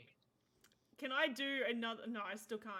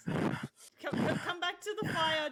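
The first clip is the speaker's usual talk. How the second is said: loud, raised, urgent coaxing; insistent higher-pitched plea; calling out; 7 semitones higher, 10 dB louder than usual. Acted. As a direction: call him back, voice up and louder. Player calls out to agitated man.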